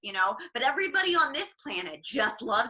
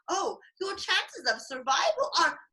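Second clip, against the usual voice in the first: mock British accent; 5 semitones higher than usual. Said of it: "why did you give him a British accent?".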